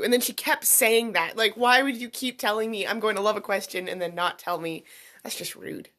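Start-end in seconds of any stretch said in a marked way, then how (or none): 5.2-5.9 whispered, pretending to be hurt; mock offended whisper. Real, not acted. Host teasing her cohost in a mock offended whisper. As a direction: breathe it like a fake complaint. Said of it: "I'm just teasing".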